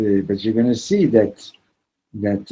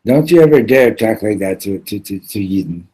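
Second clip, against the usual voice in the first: louder, forceful warning; stern rebuke; 7 dB louder than usual. Retold or imitated. Gravedigger rebuking the preacher, loud and forceful. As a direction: hit it hard, a firm warning.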